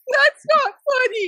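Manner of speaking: very high imitated outburst; mock outraged cry. Horrified mom to pranking kid, outraged cry. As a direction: let the reproach jump way up in pitch.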